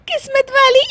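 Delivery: high, giggling echo into a laugh; mock the compliment laughing. Daughter-in-law laughing at the guest's praise, giggling.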